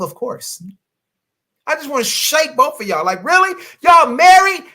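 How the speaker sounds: high, loud exasperated scolding; righteously indignant. Acted. Coach scolding sexless married couples, high and indignant. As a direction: shake them by the collar with your voice. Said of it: "Righteously indignant".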